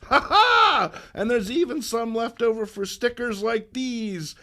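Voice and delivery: high, loud cackling crook; gloating villain. Acted. Felicity taunting Spider-Man, loud and gleeful. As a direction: crow over the beaten hero.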